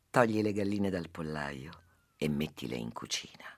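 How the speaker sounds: soft sage voice. Acted. Sage speaks softly in fable.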